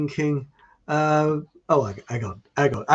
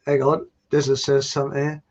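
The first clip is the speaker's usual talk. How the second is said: normal talking voice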